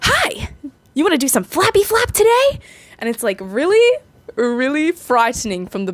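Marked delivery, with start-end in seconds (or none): 0.0-2.6 very high, sing-song greeting; overeager baby talk. Retold or imitated. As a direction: coo at the baby bird way too eagerly. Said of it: "she's like".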